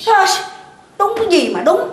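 sharp high snap; angry retort. Acted. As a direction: cut her off angrily. Mother snaps at daughter-in-law. (gắt gỏng giận dữ)

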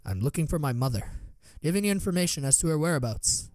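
usual speaking voice

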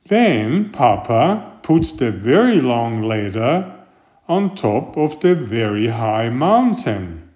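usual speaking voice

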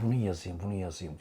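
low, soft mock self-talk; imagined Farah musing. Farah deciding what to tweet, soft mock musing. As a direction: mutter to yourself, hammed-up. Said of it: "Ay gerçekten de böyle".

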